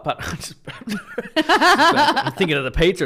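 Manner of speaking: high laughing words, slowed. Real, not acted. Laughs recalling pizza.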